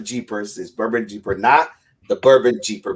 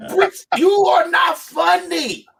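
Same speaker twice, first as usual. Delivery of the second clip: high, drawn-out mock yell; mock exasperated shout; slower than usual. Telling the British joker off, in a mock exasperated shout. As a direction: cry it out slowly in comic exasperation. Retold exasperated punchline to Brits.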